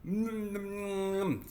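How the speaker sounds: high, long muffled hum; gagged mumbling. Acted. Little sister tied up trying to tell, muffled humming. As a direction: protest through a stuffed mouth. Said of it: "took the sock out of her mouth".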